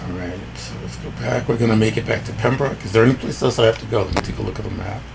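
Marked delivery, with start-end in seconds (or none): none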